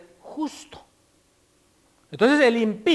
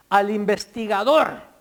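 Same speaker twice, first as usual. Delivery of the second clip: usual speaking voice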